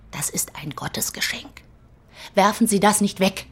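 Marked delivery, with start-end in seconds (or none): 0.1-1.6 coaxing whisper. Acted. Publisher softly coaxes the poet back, whispering. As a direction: whisper it like a secret miracle.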